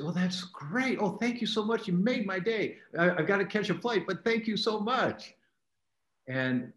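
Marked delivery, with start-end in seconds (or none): 0.0-5.3 high, bright, hurried thanks; gracious thanks. Retold or imitated. Doctor thanking a grateful patient before a flight, warmly hurried. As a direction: gush gratitude, then rush off. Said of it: "and I say".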